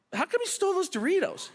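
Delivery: high, mock-accusing cop tone; asking his wife in the car, with a mock accusation; teasing question. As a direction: needle her playfully, like a cop. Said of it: "and I said".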